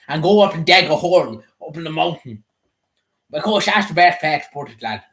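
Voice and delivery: put-on West Leitrim Sligo accent, rural; broad Leitrim Sligo country accent. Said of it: "like Leitrim accent".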